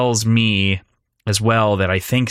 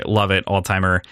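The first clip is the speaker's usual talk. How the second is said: ordinary talk, nothing marked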